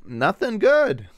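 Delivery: high, loud, drawn-out complaint; exasperated outburst. Real, not acted. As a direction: stretch it out in exasperation. Frustrated outburst at game.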